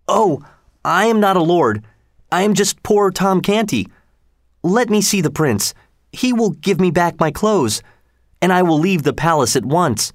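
put-on a scared boy pleading to leave; frightened poor boy. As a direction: Tom begging Lady Jane for the prince.

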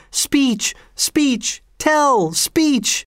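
very high, chanting calls; high-pitched clamoring; crowd cry. A narrator's reading. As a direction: call out each word like an eager crowd. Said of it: "Everybody took up the cry".

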